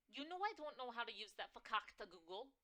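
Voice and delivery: fast, high, loud mom; pushy New York mother. Acted. Mother pressing her daughter to come for dinner, fast and shrill. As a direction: rush the dinner invite. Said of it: "My mother's shrill, New York-tinged voice".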